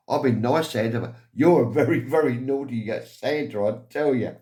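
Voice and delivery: the mum flirting with Santa; lightly voiced cheeky woman; flirty mother. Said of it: "She said".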